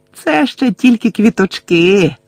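high, drawn-out gloating taunt; зловтіха Белатриси. A narrator's reading. Bellatrix gloats over her victim. (зловтішне протяжне глузування високим голосом)

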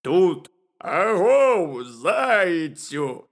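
big hallooing shout; calling out. A narrator's reading. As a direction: holler down into a burrow, vowels stretched. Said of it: "крикнув він".

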